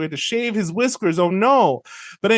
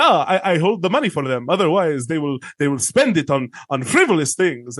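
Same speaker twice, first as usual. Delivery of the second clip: put-on captain's patter in a Middle Eastern accent. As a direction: make excuses in a playful Middle Eastern accent. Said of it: "I'm allowed to do that accent".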